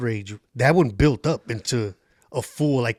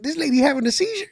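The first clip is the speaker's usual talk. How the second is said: pitched way up, teasing; mock incredulous; 13 semitones higher, faster than usual. Comic to the crowd, mock incredulous. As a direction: act shocked at her for the crowd.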